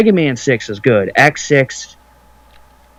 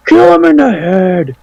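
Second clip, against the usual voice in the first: higher-pitched imitation of a shouting heckler; mock crowd shout; 7 semitones higher than usual. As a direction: call it out like someone in an angry crowd. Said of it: "shouts that".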